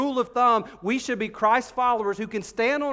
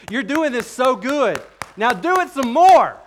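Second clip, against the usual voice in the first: cheering praise; 6 semitones higher, 10 dB louder than usual. Retold or imitated. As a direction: cheer them on like a proud coach. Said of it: "He's going".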